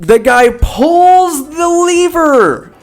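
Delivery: high, slow drawn-out announcing; mock-epic climax. Storyteller recounts the lever pull and fall, hammed-up high drama. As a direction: stretch each key word high for suspense.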